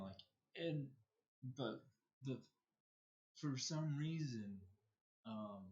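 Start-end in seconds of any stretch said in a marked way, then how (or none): none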